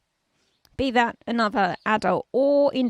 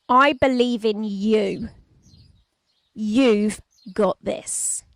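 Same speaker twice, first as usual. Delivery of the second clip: slow, louder, every word weighted; slow and emphatic; 4 dB louder, slower than usual. Shown as a demonstration. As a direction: press each word home with full conviction. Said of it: "if I say it like this".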